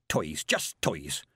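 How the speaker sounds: low, slow, scornful mutter; old witch scorning the amulets. A narrator's reading. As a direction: grumble it at the trinkets. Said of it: "Mistress Weatherwax muttering".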